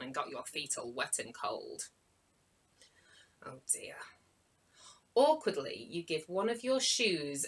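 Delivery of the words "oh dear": quieter, lower whisper; whispered oh dear. Acted. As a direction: let it sink in, barely voiced. Storyteller reacts again.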